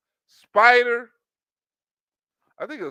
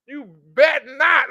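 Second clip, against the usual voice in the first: slow, higher, louder warning; drawn-out threat; 7 semitones higher, 6 dB louder, slower than usual. Retold or imitated. Woman threatening him, slow sassy warning. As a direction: stretch the threat out.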